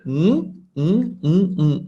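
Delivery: N as an isolated hummed nasal; nasal sound demo. Shown as a demonstration. Teacher splitting a cluster into parts, hummed nasal demo. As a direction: separate short hums of the NG sound. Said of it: "n sound with the sh".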